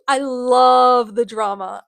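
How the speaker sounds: loud, drawn-out, savouring delight; gleeful relish. Real, not acted. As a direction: stretch it out with theatrical relish. Revels in the drama.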